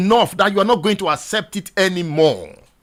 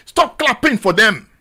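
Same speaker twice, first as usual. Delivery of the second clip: usual speaking voice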